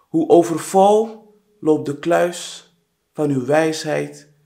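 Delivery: solemn poem reading with long pauses; poem recitation. Acted. A poet addressing their mother in verse, solemn measured recitation. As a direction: recite line by line, stressing key words.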